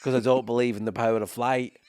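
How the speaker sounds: hammed-up English cabbie accent; mock English accent. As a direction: mimic an officious English taxi driver. Same mock voice for Uber driver.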